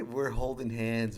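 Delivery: ordinary talk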